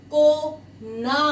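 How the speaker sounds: को ना drawn out slowly with a break between syllables; slow clear syllables. Shown as a demonstration. Teacher to pupils, sounding out को ना slowly. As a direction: spell out को ना for the class. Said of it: "very clear".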